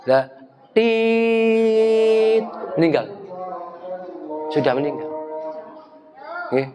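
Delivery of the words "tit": monitor beep. Retold or imitated. Imitating heart monitor beep.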